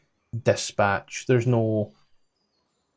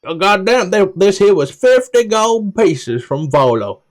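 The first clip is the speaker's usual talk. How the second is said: much higher and louder, proud of the purchase; high NPC voice; 9 semitones higher, 7 dB louder than usual. Acted. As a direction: guide answering about the book's price. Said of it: "How much did you pay".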